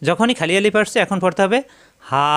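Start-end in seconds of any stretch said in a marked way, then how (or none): 2.0-2.2 হা with the a held long; long drawn vowel. Shown as a demonstration. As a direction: draw out হা one alif. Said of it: "লম্বা করতে হবে". (হা লম্বা করে টেনে)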